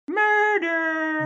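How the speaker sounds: shouted murder. Acted. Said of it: "my favorite one".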